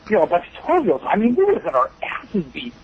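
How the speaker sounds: usual speaking voice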